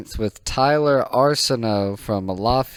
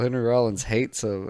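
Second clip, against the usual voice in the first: ordinary talk, nothing marked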